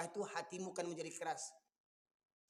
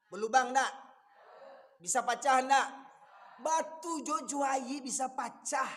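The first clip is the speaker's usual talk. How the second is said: louder, slow stressed questions; emphatic preaching; 5 semitones higher, 6 dB louder, slower than usual. Real, not acted. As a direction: drive each word home. Preacher drives home the stone image. (ceramah tegas dan menekan)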